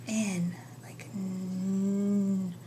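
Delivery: very slow whispered n sound; teacher isolating the n sound in a whisper. Shown as a demonstration. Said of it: "say it really slow".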